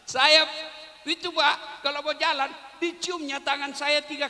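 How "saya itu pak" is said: normal talking voice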